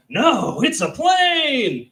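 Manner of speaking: high, slow, loud correction; drawn-out shouted claim; second guy. Retold or imitated. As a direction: bystander insisting it's a plane. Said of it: "the second guy's like".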